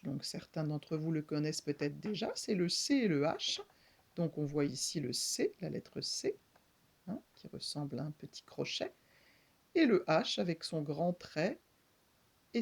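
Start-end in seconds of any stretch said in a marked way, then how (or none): none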